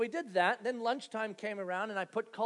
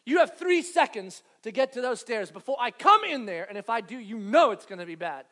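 stern warning; 8 dB louder than usual. Retold or imitated. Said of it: "and I say".

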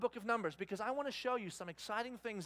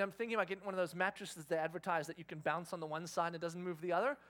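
usual speaking voice

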